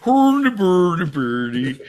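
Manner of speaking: high, loud Swedish gibberish; hammed-up Swedish singsong nonsense; mock Swedish. Playing a Swedish reindeer bit, loud mock-Swedish gibberish. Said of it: "Was that the Swedish, uh, uh, reindeer?".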